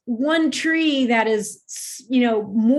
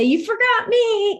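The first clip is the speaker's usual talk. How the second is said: much higher, tiny plant voice; plant's little voice; 12 semitones higher than usual. Retold or imitated. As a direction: squeak it out like a small plant. Plant speaks in story.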